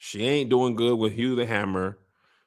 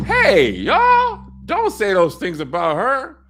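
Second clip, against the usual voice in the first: loud, much higher "Hey, y'all" call-out; defensive shout; 11 semitones higher, 8 dB louder, slower than usual. Retold or imitated. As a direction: yell at the crowd to stop the gossip. Ulf defends the queen.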